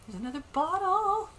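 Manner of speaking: high, loud delighted outburst; excited discovery. Real, not acted. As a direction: exclaim at a lucky find. Gasps at finding another bottle.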